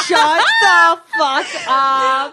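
shrieking with laughter; laughing shout. Real, not acted. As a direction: scream it through laughter. Reacts to shocking story.

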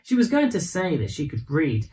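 usual speaking voice

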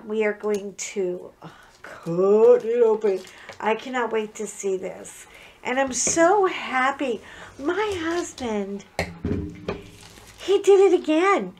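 ordinary delivery

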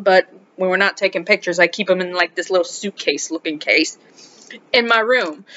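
normal talking voice

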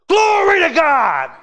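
a big, high, slow cry of praise; joyful shout. Retold or imitated. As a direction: shout it out like a man set free. Preacher imitates Prather shouting in church.

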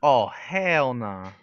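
slow, loud, stretched "hell"; drawn-out refusal. Real, not acted. Gamer reacting to a bad turn, drawn-out refusal. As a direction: drag it out in disbelief. Refusing what happens in game.

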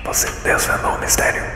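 whispered puzzle-solving, no voice; ASMR whisper. Pretend. Streamer guessing the animal puzzle aloud, ASMR whisper. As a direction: think aloud entirely in whispers. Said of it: "A S M R na caverna".

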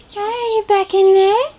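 high, sing-song coo at the nest; high pet voice. Real, not acted. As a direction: coo gently to a tiny bird. Talking to baby bird.

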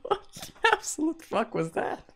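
high-pitched, laughing through the words; cracking up, barely able to get it out; laughing disbelief. Real, not acted. Reacting to the exploding bride, laughing in disbelief. Reacting to bizarre cartoon scene.

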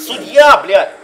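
loud frustrated yell; shouting. Real, not acted. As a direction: yell at the referee. Yelling at the referee.

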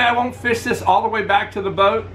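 usual speaking voice

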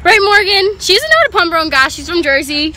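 loud, high, excited road trip voice; loud excited chat. Real, not acted. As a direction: shout over the car noise, excited.

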